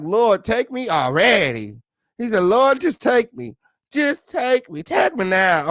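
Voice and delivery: high, drawn-out plea to the Lord; mock pleading. Retelling the nonchalant husband's prayer, mock pleading.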